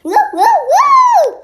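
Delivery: high loud whooping cheer; excited whoops. Real, not acted. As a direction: whoop it out with glee. Child cheering in play.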